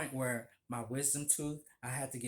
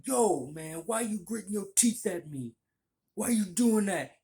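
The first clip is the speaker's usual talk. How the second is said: louder, higher, mock aggressive yell; hammed-up street tough challenging; confrontational guy; 7 semitones higher, 7 dB louder than usual. Stranger confronting the narrator, mock angry and loud. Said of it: "this guy was like".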